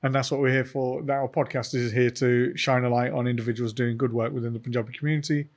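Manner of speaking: usual speaking voice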